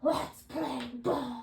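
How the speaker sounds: slow growly monster voice; monster growl. Pretend. Trying to read as if a monster, growling. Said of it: "Read as if you were a monster".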